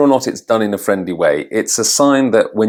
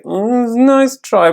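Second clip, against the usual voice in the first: much higher, tactful mock praise; polite hedging; 3 dB louder than usual. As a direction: imitate a native speaker letting someone down politely. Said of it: "trying to find a polite way to say".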